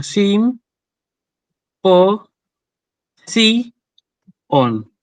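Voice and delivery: "sim, po, si, on" in separate syllables, long pauses between. Shown as a demonstration. Teacher dividing a Greek word for students, syllable by syllable. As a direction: split "sim, po, si, on" into four slow beats, last one dropping. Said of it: "Si yo la separo".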